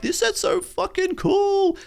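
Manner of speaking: squeaky, slow, hammed-up fanboy gush; mock gushing excitement. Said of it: "I'm out here like".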